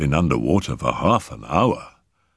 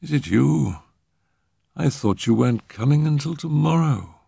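put-on high, feeble young man; weakly; 9 semitones higher than usual. A narrator's reading. Revived Arthur recognising Phyllis, weakly. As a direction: speak faintly puzzled, just revived. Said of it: "he said weakly".

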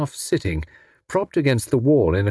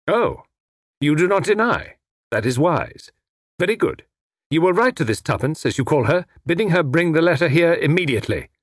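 silky, higher-pitched villain voice; commanding villain; 6 semitones higher than usual. Acted. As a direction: dictate terms to the captive, lightly imperious. Villain dictates terms.

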